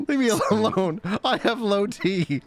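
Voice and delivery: hammed-up tearful protest, drawn out at the end; mock crying whine. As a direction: wail it in mock self-pity. Acts himself crying at his wife.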